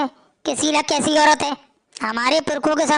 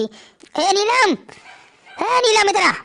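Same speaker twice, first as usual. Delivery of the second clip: calling out; 5 semitones higher, much slower than usual. Acted. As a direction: summon her sharply from another room. Mother-in-law summons daughter-in-law.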